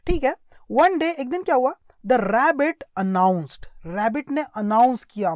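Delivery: normal talking voice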